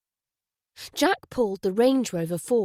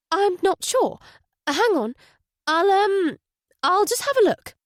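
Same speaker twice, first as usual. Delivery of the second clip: much higher and hesitant, a poor liar; nervous lying wife; 10 semitones higher, 5 dB louder than usual. A narrator's reading. Wife stalling the detective, lying badly. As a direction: fumble the excuse nervously. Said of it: "wasn't a very good liar".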